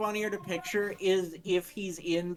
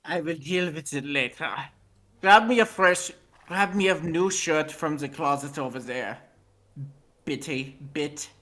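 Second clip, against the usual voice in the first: put-on German accent; 2 semitones lower, 4 dB louder than usual. Medic speaking in character.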